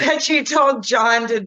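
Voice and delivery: laughing through the words. Real, not acted. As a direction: giggle while speaking. Laughing while recalling.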